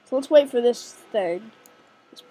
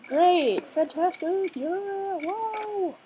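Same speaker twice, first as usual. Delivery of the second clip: sarcastic, rising higher and softer; 7 semitones higher, 4 dB quieter, much slower than usual. Real, not acted. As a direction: fake delight, climbing up. Unknown button, mock enthusiasm.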